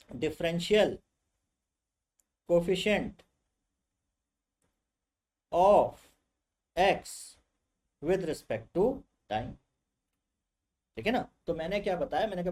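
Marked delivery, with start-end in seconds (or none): 0.1-9.5 drawn out term by term with long pauses; slow, portentous technical pronouncement; intimidating jargon. Shown as a demonstration. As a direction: unveil the scary term one piece at a time. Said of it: "डराने के लिए मैंने क्या बोल दिया".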